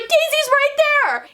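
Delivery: high-pitched, drawn-out mock yelling. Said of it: "Simmons immediately goes and yells at him".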